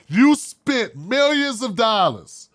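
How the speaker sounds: ordinary delivery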